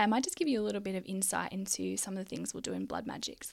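usual speaking voice

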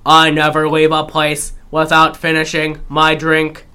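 stiff monotone, evenly spaced words; mechanical, level line reading; robotic delivery. Retold or imitated. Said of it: "robotic as always".

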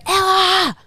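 high, loud imitation of a child yelling; retold scream. Little girl calling her babysitter from the couch, mock scream. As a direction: mimic a kid bellowing for the babysitter. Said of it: "Scream for me to go".

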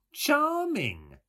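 very high, loud, fussy sweetness; finical sweet voice. A narrator's reading. Mulligan delighted at Stephen's answer, finically sweet. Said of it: "in a finical, sweet voice".

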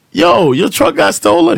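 high, drawn-out exclamation; acted shock. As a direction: exclaim it high and slow in disbelief. Acts out his shocked exclamation.

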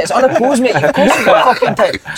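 high, incredulous protest through laughter; protest, cracking up as you go; laughing exasperation. Retold or imitated. Player answering manager in training, laughing disbelief.

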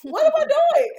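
high, loud laughing question; laughing disbelief. Retold or imitated. Mom laughing at herself dancing to a kids' movie, high and loud. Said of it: "I'm like".

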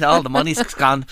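usual speaking voice

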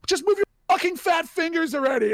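high, loud exasperated outburst; retold angry outburst. Boss losing patience with a colleague's fingers, angry outburst. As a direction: burst out impatiently, high and loud.

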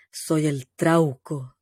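low, slow mock monster voice; grandma faking a scary deep voice; masculine voice. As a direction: growl it like a creature on the phone. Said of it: "ponía una voz como más masculina".

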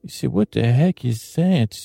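ordinary talk

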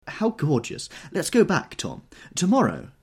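high, bright gushing delight. Acted. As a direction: gush over it breathlessly. Daisy gushing in dialogue.

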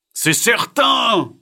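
high, loud, drawn-out exclamation; emphatic scolding. Acted. Diego confirms frog was fooled, loud exasperated shout.

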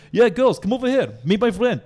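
loud, high mock-Russian shout; yelling Russian guy. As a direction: call them over like a pushy Russian. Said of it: "He's, like, yelling at them".

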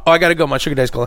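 ordinary talk

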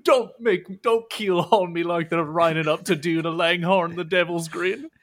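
loud, stammering apology with bits of laughter; flustered clumsy oaf. Acted. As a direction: play a panicky oaf begging forgiveness. Game master plays Horace apologizing.

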